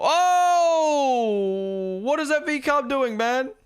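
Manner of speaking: loud, high drawn-out "oh", then high-pitched disbelief; startled high yell of disbelief; real alarmed outburst. Real, not acted. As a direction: cry out at a near miss on track. Reacting to a risky on-track moment.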